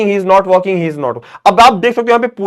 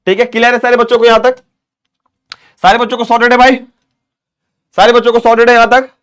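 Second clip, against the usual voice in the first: loud, high call to the whole class; rally the students, are we all clear; upbeat teacher checking everyone is with him; energetic check-in; 8 semitones higher, 7 dB louder than usual. Acted. Calling out to class.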